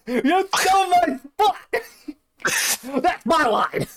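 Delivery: laughing mock outrage. Mock wrestling spot-calling.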